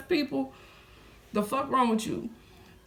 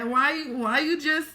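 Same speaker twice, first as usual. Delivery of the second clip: ordinary talk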